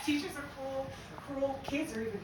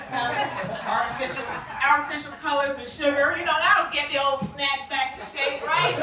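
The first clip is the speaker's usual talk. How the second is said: mock pitch; 9 dB louder than usual. Sarcastic mock sales pitch.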